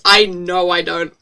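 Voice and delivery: plain reading voice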